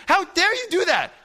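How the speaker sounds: loud, high-pitched outrage; mad brother. Retold or imitated. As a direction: retell it as a furious, indignant yell. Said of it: "he gets mad at me".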